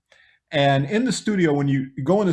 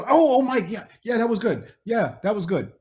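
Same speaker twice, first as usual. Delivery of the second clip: starstruck gushing; 8 semitones higher than usual. Retold or imitated. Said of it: "And we're".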